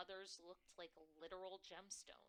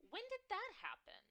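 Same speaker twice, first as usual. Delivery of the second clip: higher, louder New York mom voice; shrill New York mother; 7 dB louder than usual. Acted. Mother on the phone to her daughter, shrill and loud. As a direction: bark it down the phone like a pushy mom. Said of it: "My mother's shrill, New York-tinged voice".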